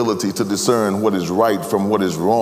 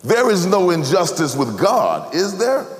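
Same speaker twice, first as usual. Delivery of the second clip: raised, pressing question; 8 semitones higher than usual. Shown as a demonstration. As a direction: press the question, pitch rising. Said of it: "And the question is this".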